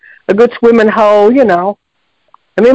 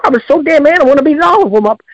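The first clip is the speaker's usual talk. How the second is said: normal talking voice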